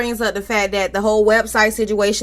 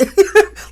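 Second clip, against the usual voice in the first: high laugh burst; 10 semitones higher, 5 dB louder than usual. Real, not acted. Laughing at Vegas cards.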